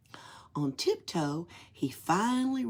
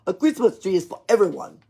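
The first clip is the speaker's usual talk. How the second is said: put-on fast, louder Russian-accented line; Russian accent, chuckling; 10 dB louder, much faster than usual. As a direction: explain it warmly in a Russian accent. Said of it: "the Nutcracker chuckled".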